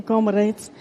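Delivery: ordinary talk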